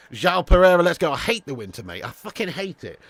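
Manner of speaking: ordinary delivery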